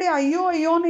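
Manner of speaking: lightly imitated shriek; retold cry of alarm. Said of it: "கத்தினவாளா இவா".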